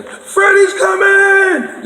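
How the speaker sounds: high, slow drawn-out yell of alarm. Retold or imitated. Warning everyone on the street that Freddy's coming, a long high yell. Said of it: "And I'm yelling".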